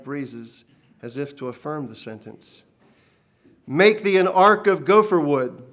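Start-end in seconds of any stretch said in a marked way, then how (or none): 3.7-5.6 loud, commanding voice of God; a towering divine command; booming voice. Acted. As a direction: boom it out like thunder from the mountain. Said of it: "the voice boomed".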